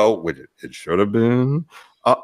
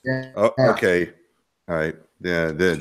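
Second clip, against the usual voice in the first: ordinary talk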